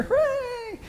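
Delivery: very high, loud crowd hooray; hammed-up squeal of a cheer; mock cheering. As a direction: cheer like a delighted crowd, tongue in cheek. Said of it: "People cheer".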